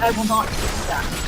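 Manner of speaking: imitating a snooty British refusal; lightly mocking British accent; condescending British accent. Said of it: "say that in a British accent, it sounds like you're a little condescending".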